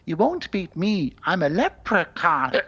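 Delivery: slow, high, loud leprechaun chant; leprechaun impression voice. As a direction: draw out the rhyme like a boastful leprechaun. Quoting the leprechaun's drinking rhyme.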